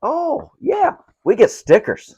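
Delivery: sudden high delighted burst; thrilled, voice jumping up; excited. Real, not acted. Delighted to find stickers inside.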